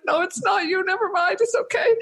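high, mock-tearful reassurance; imitated weepy squeak; retold crying. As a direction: re-enact blubbering through a reassurance. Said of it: "I burst out crying".

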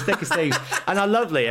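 laughing. Real, not acted. Laughing at own story.

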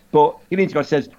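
ordinary delivery

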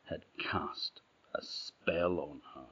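hushed, breathy secret; whispering. A narrator's reading. Narrator reveals the troll's spell.

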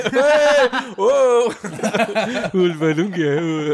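loud high teasing call; excited playful shout. Acted. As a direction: cheer it out, then slow teasing question. Calling out playfully, laughing.

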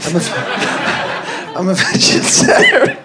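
high, laughing parody of his own line; laughing mock voice. Guest mocks the line the host just quoted, laughing. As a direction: squeak it out through laughter.

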